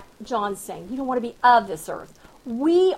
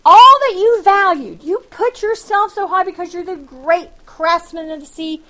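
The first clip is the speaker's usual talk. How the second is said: God's rebuke; 4 semitones higher, 9 dB louder than usual. Acted. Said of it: "And God's saying".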